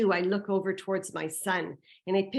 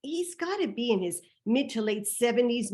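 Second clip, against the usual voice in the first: usual speaking voice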